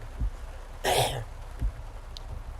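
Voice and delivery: raspy hacking cough; coughing fit. Real, not acted. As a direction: cough to clear the bug. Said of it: "I literally swallowed a bug".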